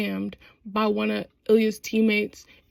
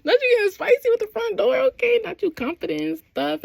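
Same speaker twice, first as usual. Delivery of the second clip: high, sassy teasing squeal; 12 semitones higher than usual. Retold or imitated. Ilya teasing Shane about the front door, high sassy squeal. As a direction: tease him like a giggly best friend. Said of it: "It was like".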